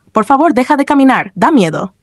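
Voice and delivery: ordinary delivery, nothing marked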